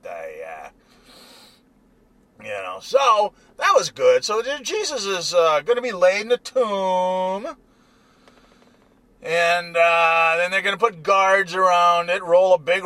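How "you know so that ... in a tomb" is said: ordinary delivery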